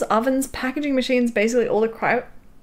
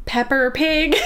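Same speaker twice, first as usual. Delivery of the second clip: higher American "Pepper Pig" with sounded r; American accent; 5 semitones higher, 3 dB louder, slower than usual. Shown as a demonstration. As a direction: try it with an American r. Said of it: "you pronounce the second R".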